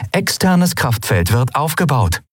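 even, mechanical ship announcement; ship computer. Acted. The ship reporting to the crew, mechanical and even. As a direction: report it like a machine. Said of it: "vermeldete das Schiff".

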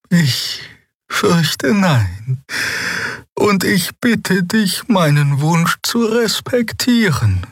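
put-on high, slow voice of a gentle old healer; old healer Xea. Xea, the old healer, speaking high and gentle.

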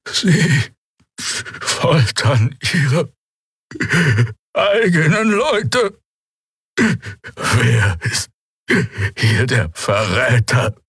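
high, slow, strained alien voice through loudspeakers; distorted prisoner voice. Acted. Captive Draxül accuses his torturers, high strained and shouting. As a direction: drag out the words, then shout the middle. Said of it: "Die Worte waren nur schwer zu verstehen".

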